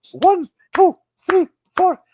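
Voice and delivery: high, loud mock drill count; stentorian counting. Dance partner keeping the rhythm for everyone, imitated stentorian count. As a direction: call out the beat forcefully, imitating a strict dancer. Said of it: "she was so stentorian too".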